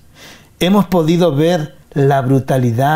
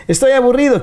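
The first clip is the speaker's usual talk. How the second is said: high, whiny bored complaint; bored whine; 11 semitones higher, faster than usual. Acted. Radio host acting out a bored listener, high whiny complaint. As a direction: whine it like a restless kid with nothing to do.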